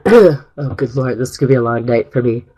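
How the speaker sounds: losing her voice to a cold; rough, worn-out voice; hoarse voice. Real, not acted. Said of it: "I usually have a voice for radio".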